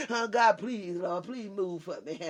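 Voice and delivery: soft, low pleading whine, hammed up; mock begging prayer. Preacher mocking begging prayers to the church, soft whine. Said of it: "'cause you begging".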